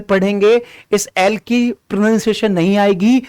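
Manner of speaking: normal talking voice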